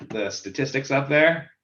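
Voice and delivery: ordinary talk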